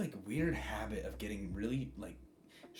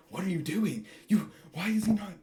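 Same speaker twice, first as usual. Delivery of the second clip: a high, loud, frantic outburst; loud, high-pitched mock panic; upset yell; 11 semitones higher, 9 dB louder than usual. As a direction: yell it at them, rattled and high. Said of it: "Ida's like".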